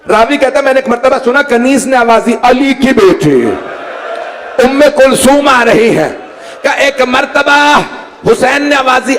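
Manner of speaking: high, impassioned mourning narration; lamenting preacher. A narrator's reading. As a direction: recount the tragedy with climbing grief. Grief narration at rising force.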